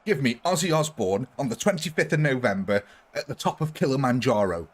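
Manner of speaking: ordinary talk, nothing marked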